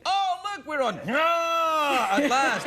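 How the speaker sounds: high, shouted excited alien. Pretend. Host imagining aliens arriving on a star, high shouted alien. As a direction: yell it like a thrilled alien landing. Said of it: "if aliens came to this planet and go".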